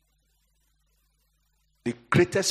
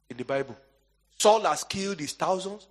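usual speaking voice

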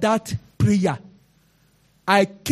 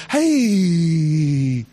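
a choked cry of wonder; a sob on one word; crying exclamation; 5 semitones lower than usual. Real, not acted. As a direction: let the word break into a cry. Cry heard.

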